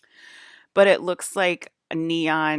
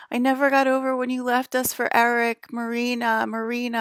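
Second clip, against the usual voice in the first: little fish voice; 7 semitones higher than usual. Retold or imitated. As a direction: plead like a tiny sad fish, up high. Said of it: "he was like".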